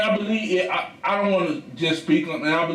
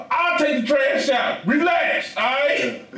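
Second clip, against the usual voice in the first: much higher, raised, fed-up promise; exasperated shout; 7 semitones higher than usual. Retold or imitated. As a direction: shout it to calm her down. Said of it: "I'm like".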